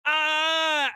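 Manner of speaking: loud, high, sustained mock wail; imitated outraged scream. Imitating a woman pounding a pillow, mock outraged scream. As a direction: scream out a staged tantrum. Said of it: "she starts pounding a pillow and".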